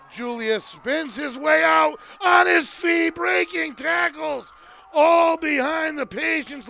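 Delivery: ringing football commentator's call; live play-by-play. Real, not acted. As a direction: call a breakaway run with rising excitement. Live play-by-play of breakaway run.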